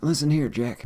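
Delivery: put-on a folksy older statesman telling someone off, lightly done; Biden impression. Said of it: "I do a Biden impression".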